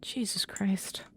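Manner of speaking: breathy frightened whisper. Real, not acted. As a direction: breathe it out, spooked. Scared by changing room.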